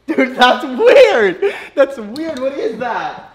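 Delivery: high, loud, drawn-out creeped-out cry. Real, not acted. Creeped out by touching.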